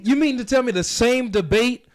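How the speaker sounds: ordinary delivery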